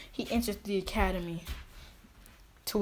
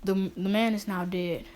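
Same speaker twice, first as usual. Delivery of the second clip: ordinary delivery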